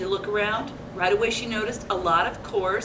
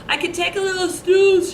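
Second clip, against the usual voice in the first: put-on higher, louder little girl voice, drowsy; a lightly voiced sleepy girl; sleepy Goldilocks; 7 semitones higher, 4 dB louder than usual. A narrator's reading. Reading to kids, Goldilocks sounds sleepy. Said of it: "she said".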